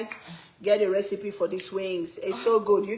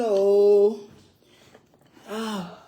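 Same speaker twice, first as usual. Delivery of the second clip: ordinary talk, nothing marked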